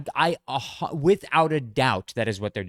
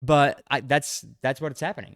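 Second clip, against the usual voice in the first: ordinary talk, nothing marked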